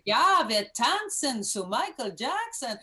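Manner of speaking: put-on German with a Cuban American accent; slow, loud German, Cuban American accent. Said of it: "speak German with my Cuban American accent".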